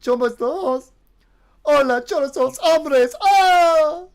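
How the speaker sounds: high, loud, slow meme chant; hyped meme voice. Pretend. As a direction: belt it out like the meme. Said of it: "what's it?".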